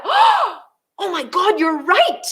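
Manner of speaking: high, drawn-out exclamation; mock epiphany. Boss struck by copywriter's insight, hammed-up amazement. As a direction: act out a sudden revelation, stretching it. Acted gasp of sudden realisation.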